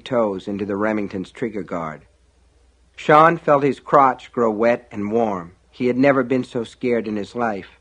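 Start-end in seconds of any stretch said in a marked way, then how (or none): none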